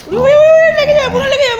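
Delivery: high, loud alarmed calling; startled cry to stop the pets fighting. Real, not acted. Pets fighting, she calls out. (চমকে উঁচু গলায় চিৎকার)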